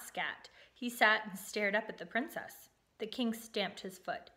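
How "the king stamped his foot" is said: ordinary delivery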